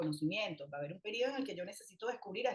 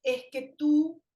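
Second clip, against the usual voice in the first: loud, slow, higher reproach; scolding parent; 5 semitones higher, 7 dB louder, much slower than usual. Retold or imitated. Mother scolds her child in a loud, slow blaming tone. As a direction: blame the child hard, dragging it out. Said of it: "reparar nunca pasa por decir".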